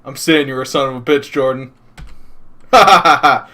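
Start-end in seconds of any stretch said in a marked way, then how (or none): 2.7-3.5 loud gloating villain laugh; evil laugh. Acted. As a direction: cackle in triumph. Villain gloats over rival.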